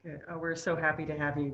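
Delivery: normal talking voice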